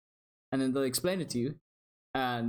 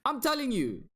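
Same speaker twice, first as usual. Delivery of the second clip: high-pitched, emphatic squabbling; playful insistence; 11 semitones higher than usual. Real, not acted. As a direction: insist in a high, mock-indignant voice. Playful squabble over who Tiga prefers.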